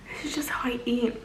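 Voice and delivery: whispered aside; whispering. Real, not acted. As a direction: whisper it like a secret confession. Unvoiced aside.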